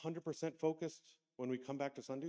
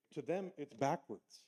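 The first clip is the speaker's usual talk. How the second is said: ordinary talk, nothing marked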